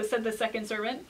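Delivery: ordinary delivery, nothing marked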